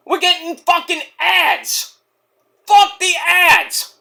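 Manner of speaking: high, slow furious complaint; angry rant. Real, not acted. Fan cursing the ads mid-match, slow and furious. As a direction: spit out the anger at the ads.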